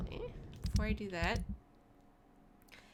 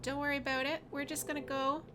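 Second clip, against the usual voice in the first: normal talking voice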